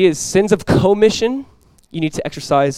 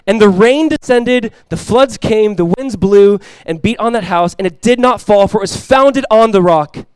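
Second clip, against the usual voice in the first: loud, high and slow preaching; ringing, drawn-out pulpit delivery; forceful scripture reading; 5 semitones higher, 7 dB louder, slower than usual. Real, not acted. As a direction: proclaim the verse to the whole congregation. Reads the house that stands.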